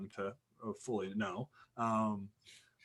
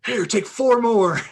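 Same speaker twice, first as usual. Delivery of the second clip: loud, slow, high angry order; Tony yelling at the guy to smoke more; mock rage; 10 semitones higher, 10 dB louder than usual. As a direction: bellow the order at him. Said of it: "uncontrolled rage".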